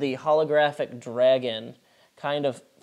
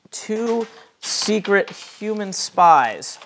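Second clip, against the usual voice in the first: slow, loud, higher dramatic announcement; dramatic reveal; 7 semitones higher, 8 dB louder, much slower than usual. Acted. Game master unveiling the background spies, dramatic reveal. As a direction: draw out each word, loud and high.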